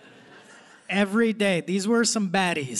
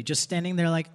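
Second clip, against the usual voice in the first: ordinary talk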